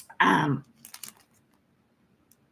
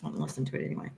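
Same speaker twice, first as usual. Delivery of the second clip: unvoiced, much softer under the breath; whispered aside; 6 semitones lower, 13 dB quieter, much faster than usual. Real, not acted. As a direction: whisper it to yourself. Quiet aside.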